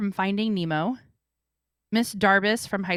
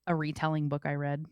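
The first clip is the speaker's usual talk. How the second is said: normal talking voice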